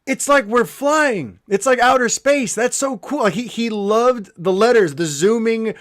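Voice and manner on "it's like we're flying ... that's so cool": son's excited awe. Retold or imitated. Dad quoting his son at the zooming letters, high excited awe. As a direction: imitate a thrilled kid watching a movie. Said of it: "My son went".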